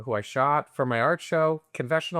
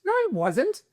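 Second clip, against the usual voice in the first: squeaky high mock protest; indignant denial. Answering his scolding girlfriend, high retold denial. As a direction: deny it with the pitch leaping up. Retold quarrel, denying the accusation.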